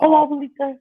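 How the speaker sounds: high cooing mock kid. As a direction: coo it like a little kid. Kids calling her grandma.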